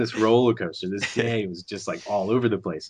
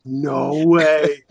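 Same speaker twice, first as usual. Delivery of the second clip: disbelief; 7 semitones higher, 4 dB louder than usual. Retold or imitated. Retold disbelief.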